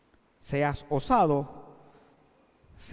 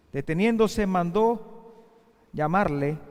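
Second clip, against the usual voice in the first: ordinary talk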